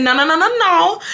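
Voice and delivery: rapid high string of no's rising to the last; sassy rapid-fire contradiction; emphatic refusal. Retold or imitated. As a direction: cut them off, insistent. Cutting off neighbor in argument.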